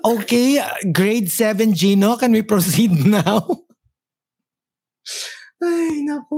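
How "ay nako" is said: high crying laugh. Real, not acted. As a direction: overcome by laughter. Laughter fit continues.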